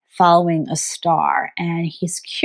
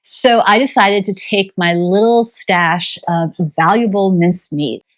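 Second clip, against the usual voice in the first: ordinary talk, nothing marked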